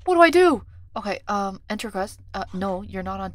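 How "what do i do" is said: high, very loud alarmed cry; panicked shout. Real, not acted. Visitor missing from list, panics.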